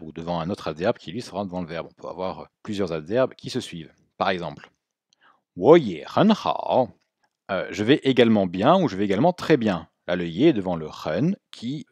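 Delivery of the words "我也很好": 我也很好 word by word, every tone drawn out; slow Mandarin teaching demo; slow model phrase. Shown as a demonstration. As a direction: give each syllable its full tone. Teacher models example sentence slowly.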